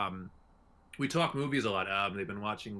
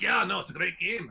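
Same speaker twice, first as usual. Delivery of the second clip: put-on Scottish accent; 7 semitones higher than usual. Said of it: "you're giving him a Scottish accent".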